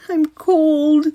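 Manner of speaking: high, loud whine; a crying kid complaining; little brother whimpering; whining. A narrator's reading.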